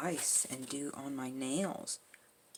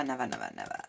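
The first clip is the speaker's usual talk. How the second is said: much lower, trailing never, never, never; much faster than usual. Real, not acted. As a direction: drop way down for the last nevers. Exasperated repeated never.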